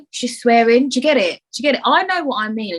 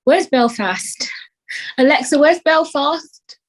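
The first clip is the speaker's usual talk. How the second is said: slow, raised put-on Irish accent; 7 semitones higher, slower than usual. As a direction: call to the smart speaker in an Irish brogue. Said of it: "It's very addictive".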